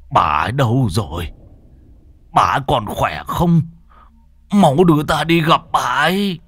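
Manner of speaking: anxious questions building to a cry; urgent pleading. Acted. Father urgently asks after her.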